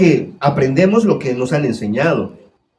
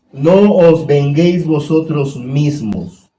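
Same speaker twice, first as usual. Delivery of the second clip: scripture reading; slower than usual. Acted. Reading the verse.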